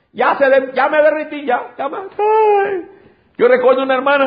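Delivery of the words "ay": mock wail. Mock dread at tumor news.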